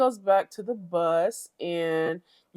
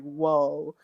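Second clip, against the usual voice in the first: ordinary talk, nothing marked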